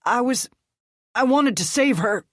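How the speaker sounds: high, loud choking plea; strained wheezing plea; wheezed. Acted. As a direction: gasp it out while being strangled. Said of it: "Reed wheezed".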